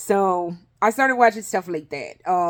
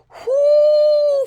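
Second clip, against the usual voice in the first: high whoo; 7 dB louder than usual. Real, not acted. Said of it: "fucked me up".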